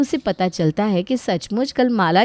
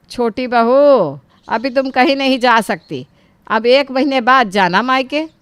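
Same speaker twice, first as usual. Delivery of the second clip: stopping her; 3 semitones higher, 5 dB louder than usual. Acted. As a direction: call out to halt the daughter-in-law. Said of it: "सरला उसे रोकते हुए कहती है". (बहू को ज़ोर से टोकते हुए)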